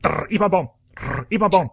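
high, loud goofy cartoon chant; dopey cartoon voice. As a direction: mimic a dumb cartoon character. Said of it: "animated gif of that with sound".

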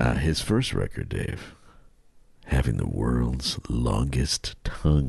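low, slow mock-sultry read; slow seduction for the ladies. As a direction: savour each word like a late-night soul crooner. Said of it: "read this a little slower for the ladies".

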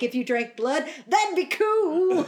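slow, high, laughing sweet tone; adorable. Retold or imitated. Quoting his adorable line, laughing and sweet. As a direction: gush it like a smitten boy. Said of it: "he says adorable things like".

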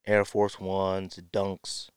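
ordinary talk, nothing marked

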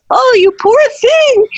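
very high, cooing pity; pitying nurse. Retold or imitated. German nurse pitying the ballerina's feet, high cooing pity. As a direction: coo like a fussing old nurse. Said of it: "And she goes".

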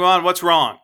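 loud gruff demand; aggressive shake. Retold or imitated. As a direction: barks it out at the girl. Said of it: "That aggressive thing".

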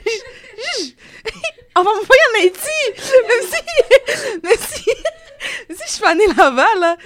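high-pitched, breaking into laughter; laughing mock alarm. Daughter jokes she'd be shipped to Haiti, laughing mock alarm. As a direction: joke in a high voice through laughs. Joking about being sent to Haiti.

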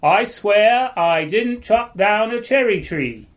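high, very slow fake British accent; attempted British accent. Shown as a demonstration. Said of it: "do a different British, uh, British accent".

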